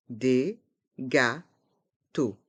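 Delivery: slow "Des gâteaux", a gap between syllables; learner-paced, split "Des gâteaux". Shown as a demonstration. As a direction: separate each sound of "Des gâteaux". Teacher breaks phrase into syllables.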